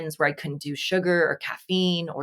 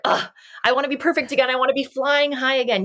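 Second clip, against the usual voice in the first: higher, fast mock complaint; mock whiny; 8 semitones higher than usual. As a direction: whine it like a spoiled perfectionist. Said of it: "we are so quick to just be like".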